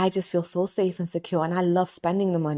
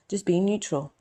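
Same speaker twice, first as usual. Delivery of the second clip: normal talking voice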